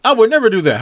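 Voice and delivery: protesting friend; a friend swears he never would; indignant denial. Acted. Friend insists loudly he would never.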